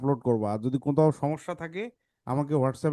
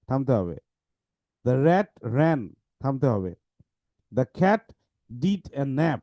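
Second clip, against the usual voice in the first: slow, pausing hard after each sentence; pause at full stops; much slower than usual. Shown as a demonstration. Teacher demonstrating stopping at each full stop. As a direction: read each short sentence slowly, then stop. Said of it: "দেখো এখানে কিন্তু ফুল stop আছে".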